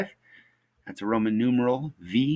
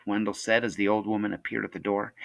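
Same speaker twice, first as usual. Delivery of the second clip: ordinary delivery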